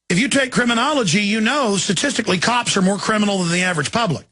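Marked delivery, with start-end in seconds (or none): none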